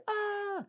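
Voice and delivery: high, hammed-up little-girl shriek; mock girly scream. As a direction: squeal like a scared little girl, as a joke. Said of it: "didn't wanna yell and be like a little girl".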